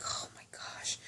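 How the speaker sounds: breathless horrified whisper; shocked whisper. Retold or imitated. Friend hits head on door.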